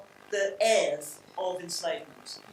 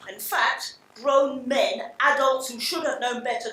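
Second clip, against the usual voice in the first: usual speaking voice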